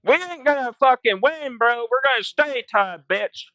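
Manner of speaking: high, cocky taunt. Retold or imitated. Trash talk to the rival player, high and taunting. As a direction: rub the tie in his face. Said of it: "I'm like".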